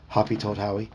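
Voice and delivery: plain reading voice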